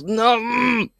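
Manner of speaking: loud, high cry of disgust; horrified cry. Real, not acted. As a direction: yelp out in revulsion. Reacting to disgusting image.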